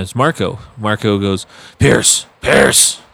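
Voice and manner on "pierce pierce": gravelly manager calling a name; raspy voice. Retold or imitated. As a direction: rasp the name out like a boss. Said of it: "with his raspy voice".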